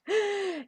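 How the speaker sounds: sharp gasp of delight. Acted. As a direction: gasp at the memory of the dress. Excited over a dress.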